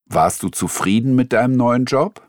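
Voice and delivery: ordinary delivery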